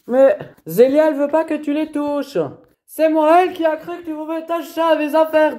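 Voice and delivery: real furious argument; angry yelling. Real, not acted. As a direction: shout her down. Argument over who touched things.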